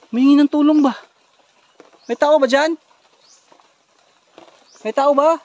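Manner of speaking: loud, high searching questions; calling out. Acted. Looking for someone who asked for help, calling out. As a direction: call into the distance for an answer.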